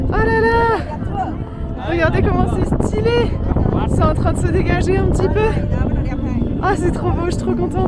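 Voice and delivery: overjoyed at the view, voice soaring; excited. Real, not acted. Reaches summit above clouds.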